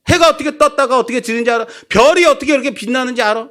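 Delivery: high, fast rapid-fire questions; God grilling Job. Acted.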